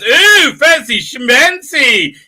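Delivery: high, loud, drawn-out teasing coo; daughter's mock-impressed cry. Daughter to a smartly suited stranger in the elevator, mock-impressed. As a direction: tease him with loud, stretched-out mock admiration. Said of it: "so she looks at the guy and goes".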